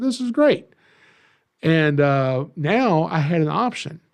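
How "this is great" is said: excited. Retold or imitated. Retold excited exclamation.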